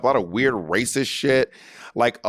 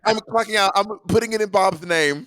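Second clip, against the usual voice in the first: Kim Chi impression; 8 semitones higher than usual. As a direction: pitch way up, playing Kim Chi. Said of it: "I'd be like".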